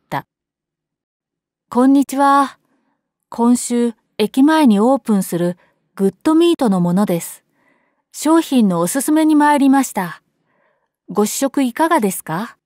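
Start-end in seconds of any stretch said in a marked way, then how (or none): 1.7-12.6 put-on a child trying to sound adult and polite; mature, courteous greeting; grown-up polite voice. A narrator's reading. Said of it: "できるだけ大人びた口調を心がけて".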